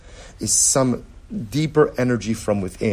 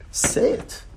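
whispered urging. Acted. Teacher urging students to speak, in a whisper. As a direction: whisper it, coaxing.

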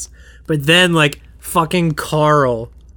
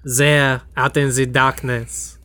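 put-on French accent. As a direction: croon it languidly with a mock French accent. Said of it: "this version of Stars being sung on a stool".

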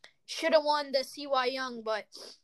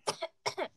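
cough; 5 semitones higher, 4 dB quieter than usual. Real, not acted. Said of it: "sorry, guys".

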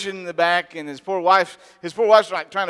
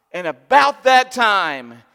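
normal talking voice

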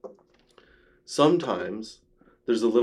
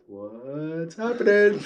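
panicky inner voice; 7 semitones higher, much slower than usual. Retold or imitated. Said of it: "that little voice in your head".

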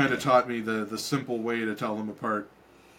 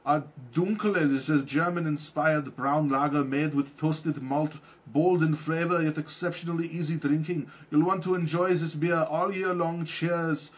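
a slightly raised, tidy promo read; label read-out; 4 semitones higher than usual. Acted. Host reads the beer label to listeners, ad-read voice.

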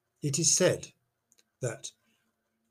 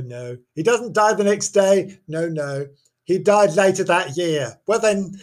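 ordinary delivery

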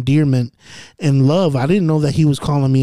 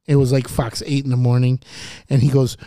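usual speaking voice

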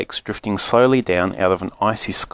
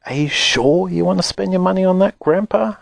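put-on higher boyish voice, doubtful; young boy, worried; 9 semitones higher than usual. Charlie questioning grandpa about the money, higher boyish worry. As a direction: ask it as an anxious young boy. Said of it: "Charlie whispered".

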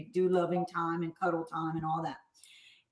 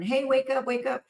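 higher, brisk call of wake up; old brisk wake-up; 6 semitones higher than usual. Retold or imitated. Mom recalls rousing her daughter, a brisk call. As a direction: snap her awake like before. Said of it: "I used to wake up my daughter and".

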